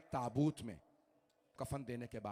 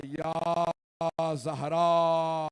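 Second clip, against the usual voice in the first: drawn-out cry, louder and slow; loud wailing cry; 9 dB louder, much slower than usual. Retold or imitated. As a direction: call her name out in grief, stretched long. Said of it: "बुलंद आवाज से गिरिया किया". (बुलंद आवाज़ में गिरिया)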